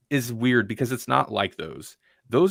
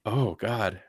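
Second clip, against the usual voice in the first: a softer, lower, lifeless mock line; flat, no emotion; 3 semitones lower, 5 dB quieter than usual. Mocking the actress's emotionless line, softer and lower. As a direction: drain all feeling from it. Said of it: "No emotion".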